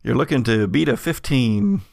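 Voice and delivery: ordinary delivery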